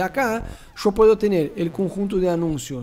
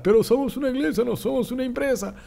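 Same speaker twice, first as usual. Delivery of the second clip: higher, fast mock protest; mocking the haters; 6 semitones higher, faster than usual. Teacher mocks haters objecting about churches, high and fast. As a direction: parody an indignant church critic, quick and high. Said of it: "Los haters ya van a saltar".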